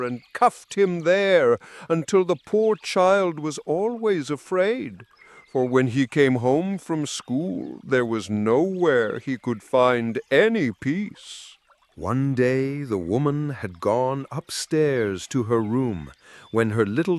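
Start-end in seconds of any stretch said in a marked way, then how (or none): none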